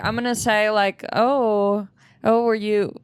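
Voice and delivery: normal talking voice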